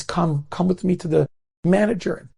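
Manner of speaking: high-pitched, pleading protest, no tears; building from pleading to indignant reproach; distraught. Retold or imitated. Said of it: "this mother is so distraught".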